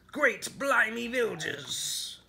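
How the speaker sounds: very drawn-out, high pirate oath; pirate exclamation. Acted. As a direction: cry it out slowly as the storm hits. Pirate exclaims as storm strikes.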